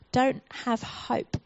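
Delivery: usual speaking voice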